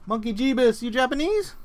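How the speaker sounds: squeaky high-pitched voice; high mock voice.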